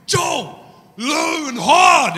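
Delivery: yelled high and loud, the last words stretched slow; furious rugby-dad roar from the stands; sideline shout. Retold or imitated. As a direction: bellow at the player from the touchline like a fired-up parent. Rugby parent yelling from sideline.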